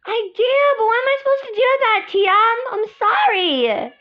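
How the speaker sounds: fast, high exasperated sister voice; exasperated big sister. Acted. Older sister snaps back at Tia, high fast exasperation.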